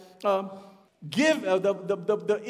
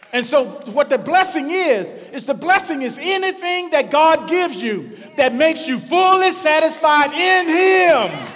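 full-force preaching; 3 semitones higher, 6 dB louder than usual. Acted. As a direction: preach it to the back row, pounding the repeated phrase.

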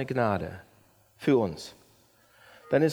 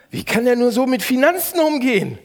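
louder, higher, contemptuous judgment; scornful complaint; 7 semitones higher, 7 dB louder than usual. Shown as a demonstration. Pastor acting out judging another driver. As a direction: sneer at the bad driver. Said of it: "Merkt ihr das?".

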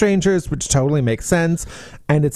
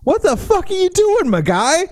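outraged disbelief; 14 semitones higher than usual. Real, not acted. Outraged at character's behaviour.

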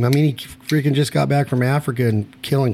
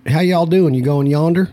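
mock Southern accent; 6 semitones higher, 3 dB louder than usual. Host wishing he talked Southern, mock Southern accent. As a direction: play a lazy backwoods Southern greeter. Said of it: "I wish I talked Southern".

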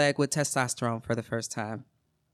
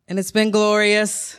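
gleeful; 6 semitones higher, 6 dB louder, slower than usual. Real, not acted. Relief at no more periods.

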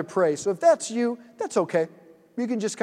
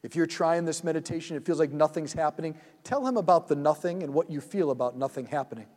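soft, slow meditation voice, lower and quieter; guided meditation; 3 semitones lower, 3 dB quieter than usual. Acted. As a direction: lead them gently into the scene, unhurried.

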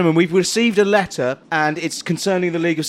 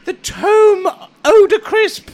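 scribe voice; 10 semitones higher, 4 dB louder than usual. Acted. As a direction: play the fussy self-important scribe. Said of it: "this character does not work".

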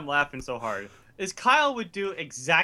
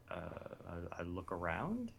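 unsure mumble; 8 semitones lower, 16 dB quieter than usual. Retold or imitated. Retelling his own unsure reply.

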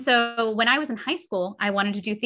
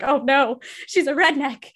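mock horror; 4 semitones higher, 4 dB louder than usual. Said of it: "has a heart attack".

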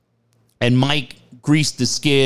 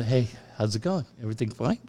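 much softer, friendly low-key chat; hushed small talk; 3 semitones lower, 9 dB quieter, faster than usual. Retold or imitated. Hypnotized guy chatting with strangers, softly. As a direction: talk quietly to the people beside you.

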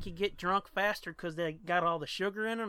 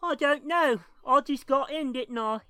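high British accent, lightly voiced; British man; 7 semitones higher, 3 dB louder than usual. Joke teller voices the fallen man, lightly British. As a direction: deliver the punchline as a dazed British man. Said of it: "Dude laying on the ground says".

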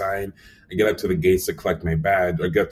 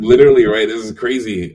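excited exclamation; 3 semitones higher, 8 dB louder than usual. Real, not acted. Agreeing excitedly.

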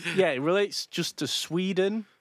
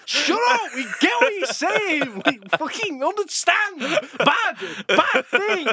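higher and louder, fed up, slowing right down on the last words; mock exasperated shout; 12 semitones higher, 5 dB louder than usual. Mocking the viewer fed up with the guru's synonyms, hammed-up shout. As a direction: snap at him to shut up, laughing at the end. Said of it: "you're like".

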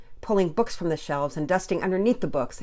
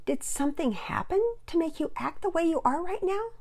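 higher-pitched concerned mother; mother's worried question, pitched up; 13 semitones higher than usual. A narrator's reading. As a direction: gently probe what is wrong. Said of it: "Mama Peters seemed concerned".

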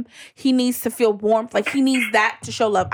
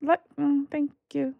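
soft, hesitant, half-hearted thanks; faked affection; 7 dB quieter, slower than usual. Real, not acted. Answering a friend's I love you, badly faked warmth. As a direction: try and fail to return the love. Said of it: "tried to fake like she was gonna say it back".

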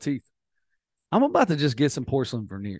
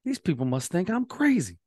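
incredulous; 6 semitones higher than usual. Retold or imitated. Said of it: "I was like".

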